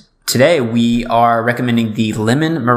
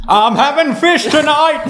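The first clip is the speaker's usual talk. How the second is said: squealed through laughter, much higher; laughing high shout. Pretend. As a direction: yelp it out laughing. Silly laughing shout joke.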